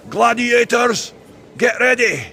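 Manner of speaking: high, drawn-out announcer shout; arena announcer call. Acted. Host calling gladiators to the arena, booming announcer call. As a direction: call the fighters out, long and high.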